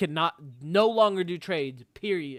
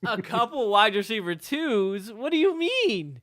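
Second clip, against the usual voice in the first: incredulous; 5 semitones higher, 5 dB louder than usual. Real, not acted. Incredulous echo of co-host's claim.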